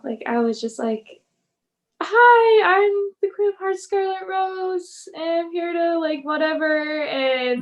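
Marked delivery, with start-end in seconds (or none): 2.0-7.0 high, loud, nervous stage greeting; hammed-up nervous announcer, slowing down at the end; awkward first-time host. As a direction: imitate her own clumsy ring intro, much higher. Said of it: "I was so bad my first show".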